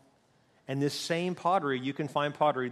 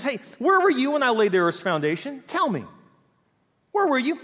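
higher, louder taunting interrogation; God challenging Job; 8 semitones higher, 5 dB louder than usual. Acted. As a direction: demand an answer you know they lack. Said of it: "He says".

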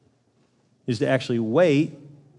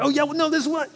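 much higher, huffy defensive retort; defensive comeback; 11 semitones higher, faster than usual. Retold or imitated. Accused spouse fighting back, huffy. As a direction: snap back like a cornered boxer. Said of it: "come out boxing".

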